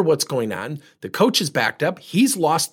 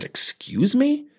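slow, rising offended query; taken aback; 10 semitones higher, much slower than usual. Retold or imitated. Mother to adviser who challenged her, taken aback. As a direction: draw it out high in mock disbelief.

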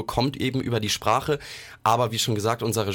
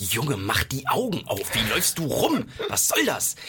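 exasperated reproach; 3 semitones higher than usual. Acted. Exasperated imagined reproach to the character.

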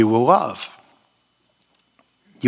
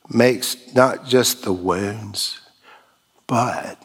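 ordinary talk

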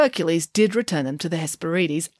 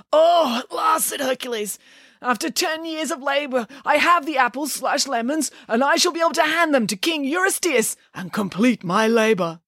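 high, excited heroic voice; a simple hero thrilled at last; high-pitched triumphant hero; eager Hercules voice; 7 semitones higher than usual. Acted.

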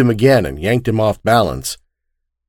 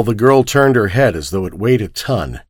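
plain reading voice

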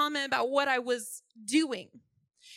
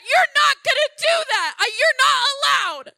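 higher, louder stern command; stern rebuke; 8 semitones higher, 6 dB louder than usual. Retold or imitated. Telling the spirit it's not allowed, stern loud rebuke. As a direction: lay down the law. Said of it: "And I said".

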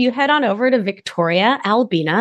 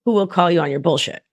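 ordinary talk

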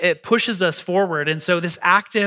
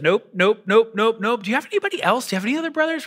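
higher, insistent voicing of the Lord; the Lord waving off each brother, rising higher; God turning sons down; 4 semitones higher, faster than usual. Preacher voicing God to the congregation, higher and insistent. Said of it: "And the Lord says".

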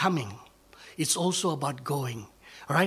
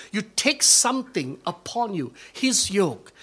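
normal talking voice

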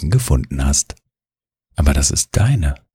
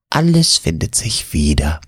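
purring cat; 2 semitones higher than usual. A narrator's reading. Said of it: "schnurrt Mieze".

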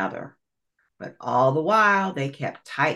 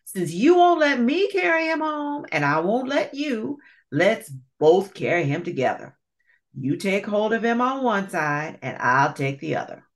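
scheming alligator; 6 semitones higher than usual. Acted. Alligator proposes compromise.

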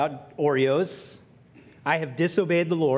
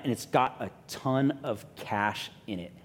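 ordinary talk

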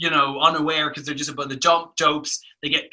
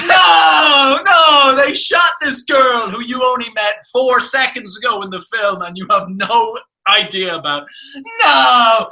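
mock anguished shout, much higher and louder; emotional cry fest; 7 semitones higher, 6 dB louder than usual. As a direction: ham up a soap-opera wail of grief. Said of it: "big emotional cry fest scene".

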